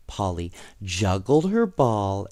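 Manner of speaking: usual speaking voice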